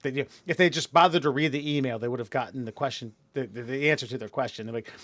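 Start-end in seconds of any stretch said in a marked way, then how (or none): none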